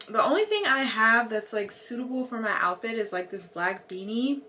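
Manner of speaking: ordinary delivery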